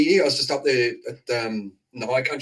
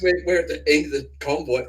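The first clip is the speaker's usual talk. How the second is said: normal talking voice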